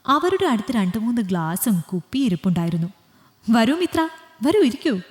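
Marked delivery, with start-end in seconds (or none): 3.5-5.0 mocking invitation. Drunk Sam mockingly invites Mithra.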